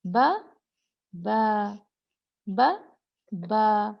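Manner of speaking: बा held two beats with the vowel drawn long; lengthened ba. Shown as a demonstration. Teacher showing students the long ba, drawn out. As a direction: draw out बा for two counts. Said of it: "उसको पढ़ेंगे बा".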